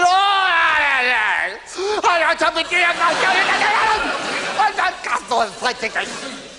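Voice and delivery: high stammering wail, mock hysterical; bawling distraught woman. Hysterical woman stammering at the agony uncle, mock bawling. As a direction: play the sobbing woman barging in, half-hammed. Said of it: "she was bawling and shouting".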